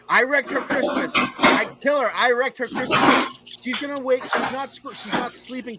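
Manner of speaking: high, loud, frantic confession; real agitated rant; worked-up outburst. Real, not acted. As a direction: pitch way up, bursting with guilt. Guilty outburst about upsetting the girl.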